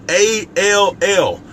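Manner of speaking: "A L L" spelled slowly, letter by letter. Shown as a demonstration. Driving the point home to viewers by spelling "A L L" slowly. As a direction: spell "A L L" out one letter at a time for weight. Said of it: "Let me put emphasis on all".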